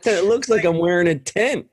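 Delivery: high-pitched, amused through laughter; real laughter breaking into the words; laughing while talking. Real, not acted. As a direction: crack up as you tell it. Cracking up over own anecdote.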